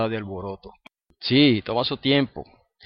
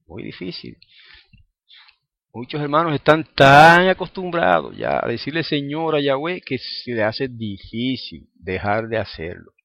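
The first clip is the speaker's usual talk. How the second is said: normal talking voice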